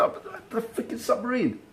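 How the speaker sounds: put-on high, desperate boy's voice; frantic kid. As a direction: insist it was the submarine, pleading. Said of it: "I said".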